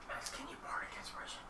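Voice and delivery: hushed, unvoiced aside; whisper. Real, not acted. Player asking Max for bardic inspiration, whispered. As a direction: whisper a request across the table. Secret request to another player, unheard.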